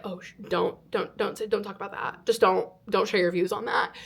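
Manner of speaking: soft, halting warning to oneself; retold quiet self-caution; self-hushing. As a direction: hush yourself urgently under the breath. Hushing herself from sharing views.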